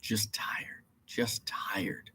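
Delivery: whispered, worn out; barely voiced tired murmur. Real, not acted. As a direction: breathe it out, exhausted. Tired mumble to camera.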